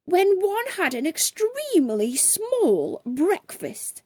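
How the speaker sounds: high, plummy indignant voice; posh gentleman protesting his missed lunch; pompous toff. A narrator's reading. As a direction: whine like a starving aristocrat. Posh man's indignant complaint.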